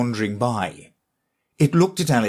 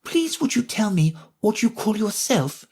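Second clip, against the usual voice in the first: higher, hesitant and shy; timid; 10 semitones higher than usual. A narrator's reading. Alice timidly asking the fawn its name, higher and shy. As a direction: ask shyly, unsure of yourself. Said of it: "she said timidly".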